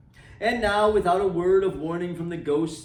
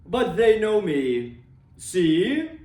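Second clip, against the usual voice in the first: spirit voice; 4 dB louder, slower than usual. A narrator's reading. Spirit pointing out the miners.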